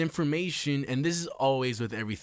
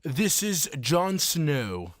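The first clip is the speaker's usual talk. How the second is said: mock grand titles; 4 semitones higher, 3 dB louder, slower than usual.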